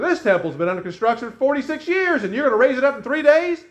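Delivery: high, climbing disbelief; incredulous objection. Acted. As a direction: build to a loud, scoffing question. Acts out incredulous reply to Jesus.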